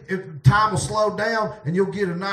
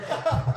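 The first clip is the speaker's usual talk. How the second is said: giggle; 8 semitones higher, 4 dB quieter than usual. Real, not acted. Keeps laughing.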